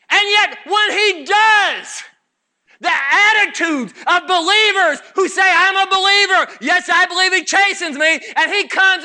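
high, emphatic pulpit delivery; full-force preaching. Acted.

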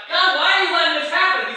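high, loud cry to God; anguished complaint. Retold or imitated. Preacher recalls questioning God, loud complaint. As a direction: wail the question upward.

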